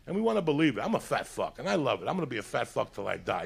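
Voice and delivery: ordinary talk, nothing marked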